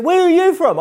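very high, loud, eager Aussie question; Aussie accent. Retold or imitated. Aussie driver quizzing a fellow countryman, excited Aussie accent. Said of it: "it's an Aussie accent".

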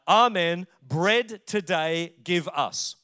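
"Amen, bread today give us." read slowly, each word weighted; solemn, very slow recitation. Shown as a demonstration. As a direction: read it slowly like a sacred text. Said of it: "That's what the Syriac translation says".

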